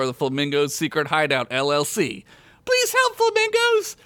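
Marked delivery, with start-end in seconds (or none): 2.6-4.0 put-on very high child voice, urgent plea; breathless little girl. A narrator's reading. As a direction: plead like a winded little girl. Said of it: "she said, panting out of breath".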